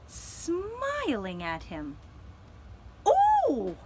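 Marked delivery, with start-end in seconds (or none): 3.1-3.8 loud, high shriek of surprise; startled cry. A narrator's reading. Hobgoblin spotting a grinning pumpkin, shrieking in surprise. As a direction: leap back and yelp. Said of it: "he cried".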